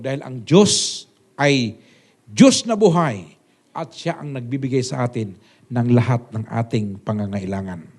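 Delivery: slow, loud preaching with pounding stress; preaching at full force. Acted. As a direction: proclaim it to the whole church. Preaching climax. (mabagal at malakas na pangangaral)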